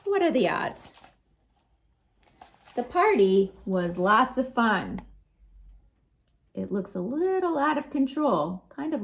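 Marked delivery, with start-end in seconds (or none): none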